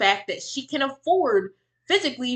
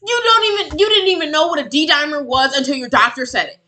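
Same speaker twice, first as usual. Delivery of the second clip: angry; 5 semitones higher, 9 dB louder than usual. Real, not acted. Angry rebuttal to clip.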